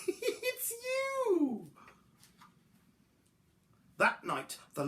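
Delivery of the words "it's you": very high duckbill voice; laugh, then hoot it up high; high amused dinosaur. A narrator's reading. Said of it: "they laughed and said".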